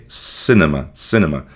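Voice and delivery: cinema with the first i like a short e, senema; English cinema. Shown as a demonstration. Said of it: "um I com som mais ou menos de E". (cinema com o i soando quase como e)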